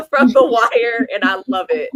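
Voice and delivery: loud, amused burst after a laugh. Real, not acted. Laughing at the [name] memory.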